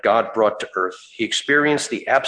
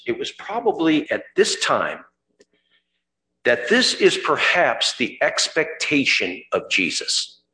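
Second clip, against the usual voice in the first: usual speaking voice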